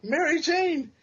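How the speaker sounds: high, ringing call of a name. Retold or imitated. Gwen clone calls out to Mary Jane, a high call. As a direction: call her name from the doorway.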